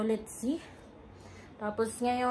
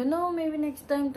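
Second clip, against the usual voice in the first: nervous refusal; 8 semitones higher, 5 dB louder, slower than usual. Retold or imitated. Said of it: "Sabi ko".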